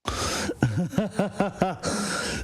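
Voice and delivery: sustained run of chuckles; real laugh. Real, not acted. Host reacting to the hypocrisy rant clip, amused laugh. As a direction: laugh helplessly at someone's rant.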